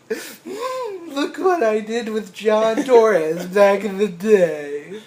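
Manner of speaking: high, loud hammed-up whine; mock wailing. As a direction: drag it out in a fake tearful wail. Acts future self, forgotten by famous friend.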